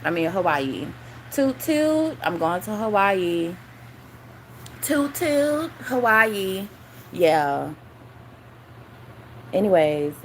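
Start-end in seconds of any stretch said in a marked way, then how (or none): 1.3-2.2 high, held toot-toot; sing-out toot toot, pitched high; mock whistle. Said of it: "I'm a whistleblower". 4.8-5.7 high, held toot-toot; sing-out toot toot, pitched high; mock whistle. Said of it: "I'm a whistleblower".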